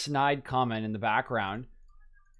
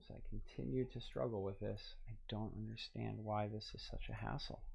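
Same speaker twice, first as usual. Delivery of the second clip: much softer, grumbling to himself; frustrated mutter; 14 dB quieter than usual. Real, not acted. Streamer grumbling to himself about the stream failing, soft and fed up. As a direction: mutter the complaint under your breath.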